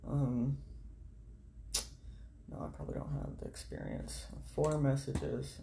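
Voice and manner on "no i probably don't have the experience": whispered aside. Real, not acted. Doubting herself.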